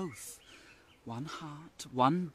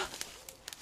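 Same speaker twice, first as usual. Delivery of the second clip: soft gasp; 13 dB quieter than usual. Acted. Puck sees the maiden.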